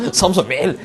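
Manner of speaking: fast, chuckling mock order to the reporter; the editor ordering Samson to write five pages, laughing fast; laughing editor's order. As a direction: give the order while cracking up, quickly. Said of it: "이렇게 하고, 그죠?".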